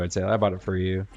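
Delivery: ordinary talk, nothing marked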